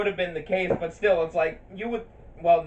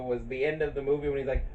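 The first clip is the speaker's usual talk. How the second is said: ordinary talk, nothing marked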